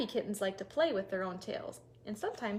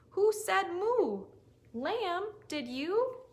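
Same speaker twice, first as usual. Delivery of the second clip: curious question; 8 semitones higher, 4 dB louder, much slower than usual. Acted. Storyteller questions animals.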